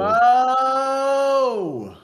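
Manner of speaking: high, loud drawn-out holler; long calling shout. Real, not acted. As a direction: bellow the name and stretch the vowel. Calling out the late co-host.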